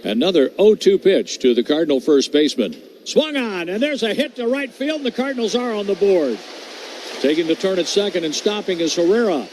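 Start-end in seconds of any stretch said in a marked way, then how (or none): 3.0-6.4 higher, rising call as the ball drops in; excited hit call. Real, not acted. Play-by-play man calling the RBI hit, excited. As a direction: lift the voice for the run scoring.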